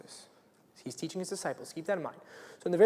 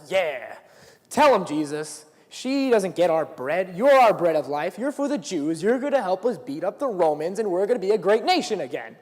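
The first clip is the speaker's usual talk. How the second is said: loud, higher, triumphant jeering; rally the crowd against her; gloating shout; 3 semitones higher, 8 dB louder than usual. Retold or imitated. Disciples voiced cheering Jesus on.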